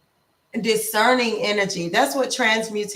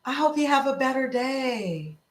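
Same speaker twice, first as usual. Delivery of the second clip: sweet well-wishing; 5 semitones higher than usual. Shown as a demonstration. Sugary kind voice to a rude driver. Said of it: "taking that energy and saying".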